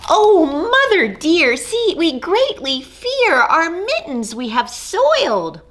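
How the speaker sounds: high, bright little kitten voices, confessing; guilty kittens. Acted. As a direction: squeak it out like small kittens owning up. Kittens confess to mother cat.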